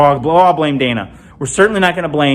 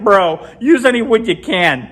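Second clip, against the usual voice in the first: laughing joke; 6 semitones higher than usual. Retold or imitated. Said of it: "I was like".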